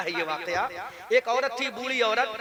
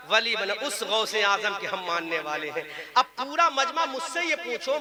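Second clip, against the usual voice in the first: ordinary talk, nothing marked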